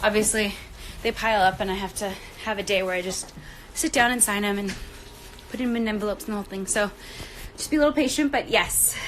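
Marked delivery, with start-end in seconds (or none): none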